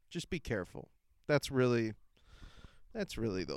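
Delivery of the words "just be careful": hushed warning. Shown as a demonstration. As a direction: say it again softly, like a secret caution. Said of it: "repeated warning".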